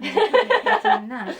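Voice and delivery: high, loud laughing question. Real, not acted. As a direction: laugh through the words. Jokes about Snoopy's Peppermint Patty.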